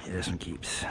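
soft whispered aside. Real, not acted. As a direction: murmur it to yourself. Quiet judging of catch.

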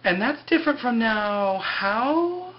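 drawn-out question soaring high at the end; mock-puzzled dig, stretched and rising; sarcastic retort. Kate challenging Locke's excuse, imitated with pointed sarcasm.

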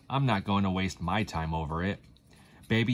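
plain reading voice

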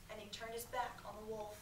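usual speaking voice

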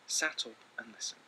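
ordinary talk

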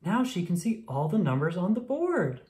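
plain reading voice

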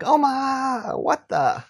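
high-pitched opening, falling on the tail; mock fear. Presenter showing viewers the fear he was going for, mock-fearful. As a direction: act out a startled, fearful exclamation. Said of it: "I was kinda going for, like, fear".

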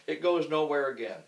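ordinary talk, nothing marked

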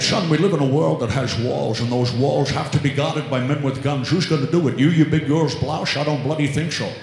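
British version. Retold or imitated.

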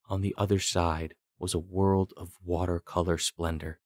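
usual speaking voice

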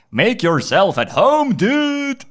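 high, slow, stretched friendly dude voice; cheery American bro. Retold or imitated. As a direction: ham up the overly friendly American. Imitating American friend's greeting.

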